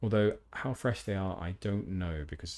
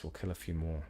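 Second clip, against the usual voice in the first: normal talking voice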